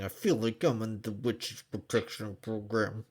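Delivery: mock grumble through the teeth; imitating a teeth-clenched complaint. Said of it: "said completely through teeth".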